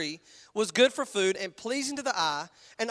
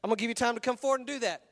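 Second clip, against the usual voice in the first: ordinary talk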